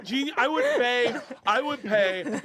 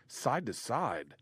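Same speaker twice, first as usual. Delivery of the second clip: slow, softer quoted answer; imitating a heckler; 2 semitones lower, 6 dB quieter, much slower than usual. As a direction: draw it out quietly as the guy's reply. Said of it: "And the guy goes".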